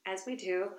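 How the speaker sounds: ordinary delivery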